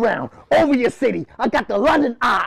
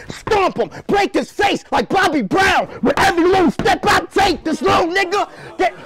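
hype shouting; 7 semitones higher than usual. Acted. Battle rapper shouting bars at his opponent. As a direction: yell it at the opponent.

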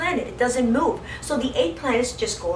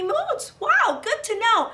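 mock delight; 13 semitones higher, 4 dB louder, slower than usual. Exaggerated delight for kids.

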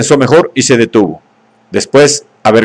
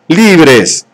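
shouted; 4 semitones higher than usual. A narrator's reading. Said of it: "gritaba el salvaje".

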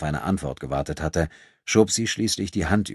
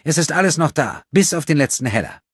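put-on high, louder, stubborn girl's voice; slightly sullen; 11 semitones higher, 5 dB louder than usual. A narrator's reading. Thief about the returned purse, high and sullen. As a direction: insist on it high and obstinate. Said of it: "Immer noch klang sie leicht verstockt".

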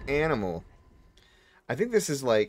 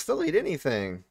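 ordinary talk, nothing marked

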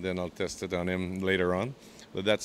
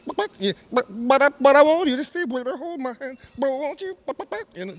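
very high soulful falsetto, louder on the peak; 7 dB louder than usual. Retold or imitated. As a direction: float it up high and aching. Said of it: "that, that sound".

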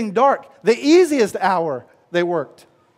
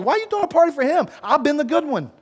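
high, fast, whining complaint; elder brother sulks at the father over the party, whiny and fast; 6 semitones higher, faster than usual. Retold or imitated. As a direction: whine like a sulky older brother. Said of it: "the elder brother's like".

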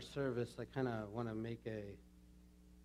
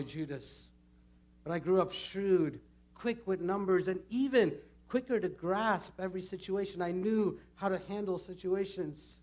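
higher, animated dramatic storytelling; Judas, proud; 7 semitones higher, 6 dB louder than usual. Acted. Judas telling the congregation of his promising youth, proud. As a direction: boast of talent and favour.

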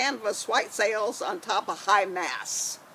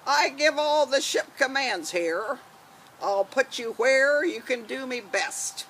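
pirate voice. Acted. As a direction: boom it out like an old sea dog. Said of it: "gonna be Janie with her pirate voice".

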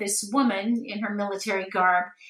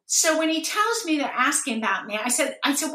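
ordinary talk, nothing marked

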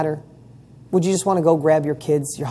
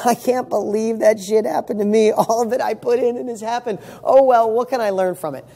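higher, amused shrug of disbelief; laughing it off; 5 semitones higher than usual. Retold or imitated. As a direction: chuckle and let it go lightly.

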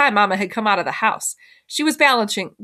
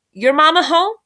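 higher, louder call; calling over; 8 semitones higher, 5 dB louder than usual. A narrator's reading. Mama questions Emma on the porch, calling over. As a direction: lift it to carry across the yard. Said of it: "Mama called over to Emma".

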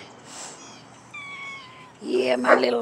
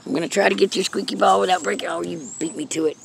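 normal talking voice

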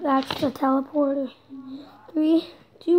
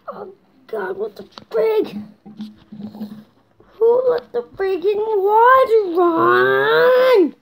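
slow, higher, drawn-out whiny yell; exasperated yell; 4 semitones higher, slower than usual. Pretend. Complaining about the running water, exasperated yell. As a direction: play-act wailing a complaint, stretching the last word.

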